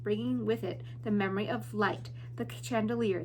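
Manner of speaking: ordinary delivery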